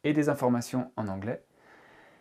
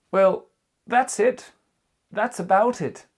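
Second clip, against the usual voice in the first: higher and louder, wrapping up; loud modelled closing; 8 semitones higher, 8 dB louder, slower than usual. Shown as a demonstration. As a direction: announce the end with a flourish. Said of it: "teacher models ending phrase". (conclusion jouée, plus forte et plus aiguë)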